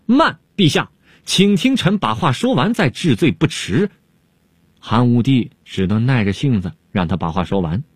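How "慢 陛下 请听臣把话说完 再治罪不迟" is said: sudden shout. Acted. Dongfang Shuo cries out to the emperor, high urgent shout. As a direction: cry out to stop him, pitched well up. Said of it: "东方朔忽然大叫了起来".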